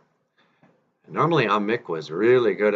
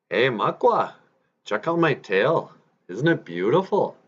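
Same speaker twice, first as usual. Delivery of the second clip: high, swooping show-off beaver; proud boasting; 5 semitones higher, 3 dB louder than usual. Acted. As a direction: show off to the bear, pitch lifted. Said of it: "he was just proud of it".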